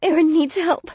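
high, loud trembling plea; near tears. Acted. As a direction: beg for help, voice shaking. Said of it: "my quavering voice on the edge of tears".

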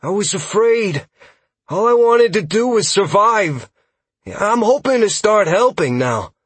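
high, loud, emotional confession; distressed, high-pitched elf voice; desperate plea. Acted. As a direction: plead to be understood. Scout pleads with the adventurers.

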